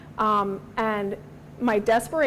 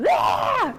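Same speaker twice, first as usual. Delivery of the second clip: higher, louder imitated child's wail; hammed-up toddler protest shout; mock child yell; 6 semitones higher, 5 dB louder than usual. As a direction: cry out like a kid refusing medicine.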